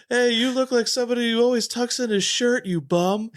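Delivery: high, hammed-up stadium heckler yelling a compliment; mock heckler. Fan mocking outfielders with nice insults, high heckler yell. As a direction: play a stands heckler making a nice line sound mean. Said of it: "that one dude that shouts out to the baseball players".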